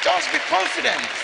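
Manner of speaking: a loud commanding call; preaching shout. Real, not acted. Preacher urging the church, loud commanding shout. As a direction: shout it out to the congregation. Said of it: "preacher exhorts at full force".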